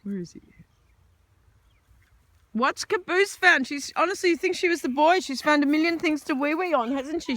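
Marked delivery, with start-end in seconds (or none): 2.5-7.4 pet talk. Real, not acted. Baby talk about dog.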